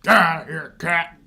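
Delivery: slow, annoyed snap at the cat; mock angry. Fed up with the noisy cat downstairs, mock angry. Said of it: "Do I get mad?".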